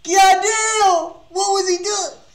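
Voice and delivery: high, loud stunned yell; shocked outburst. Real, not acted. Streamer reacting to harsh song with a loud, high shocked yell. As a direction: react like it hit you in the face.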